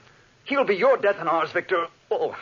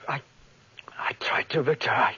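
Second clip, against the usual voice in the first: dying whisper; 5 semitones lower, 3 dB quieter than usual. Acted. Dying Henry gasps.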